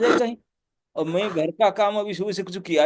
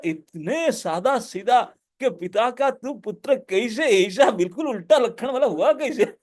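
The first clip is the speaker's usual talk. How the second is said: usual speaking voice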